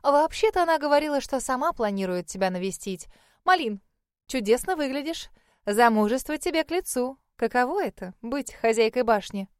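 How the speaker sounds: high, loud gushing hostess; chatty older lady. Acted. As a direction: fuss over the young bride.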